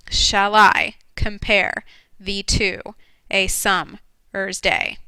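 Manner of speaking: very slow, each syllable counted out; counting the beats. Shown as a demonstration. Teacher to class counting syllables, very slow. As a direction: spell out "Shall I compare thee to a summer's day?" slowly, one beat at a time. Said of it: "so that's ten beats".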